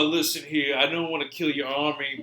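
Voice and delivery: attempted Civil War-era accent; muddled Civil War accent slipping toward Jersey; put-on Civil War accent. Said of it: "What is this accent?".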